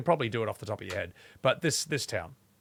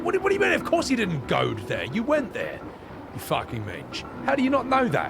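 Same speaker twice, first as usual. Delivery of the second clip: mock incredulous native; 9 semitones higher than usual. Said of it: "It's like".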